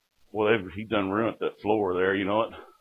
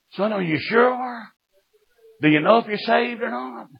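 urgent plea; 12 semitones higher than usual. Acted. Preacher pleading with a son about being saved, urgent plea. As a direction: plead in a much higher voice, stretching the words.

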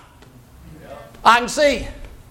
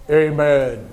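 low, tight mock-pious amen; held-back, pompous drop in pitch; uptight churchgoer; 10 semitones lower than usual. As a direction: parody a stuffy, unexcited amen. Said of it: "I get sort of tight".

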